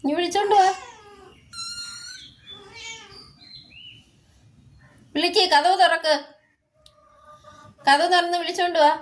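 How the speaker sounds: calling out. Real, not acted. Calling someone to bring the cat.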